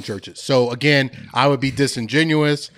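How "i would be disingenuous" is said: normal talking voice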